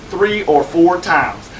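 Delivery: "Three or four times" dragged out very slowly; slow emphatic repeat. Acted. Lawyer proudly repeats his divorce count, very slow. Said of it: "I myself have done it three or four times".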